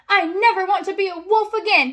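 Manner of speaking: out of breath. Acted. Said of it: "he panted".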